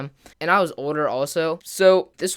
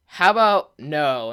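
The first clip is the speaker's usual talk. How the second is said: louder, slow, firm refusal; retold shout; 5 dB louder, slower than usual. Teller shouts to stop the fight, mildly raised refusal. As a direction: re-enact a mild shout, stretching the words. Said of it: "I just shout".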